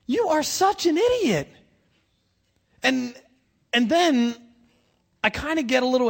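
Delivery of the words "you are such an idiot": high, mock-scolding Peter; a playful high scold at Peter; exasperated scolding. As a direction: call him an idiot in disbelief. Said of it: "And I'm like".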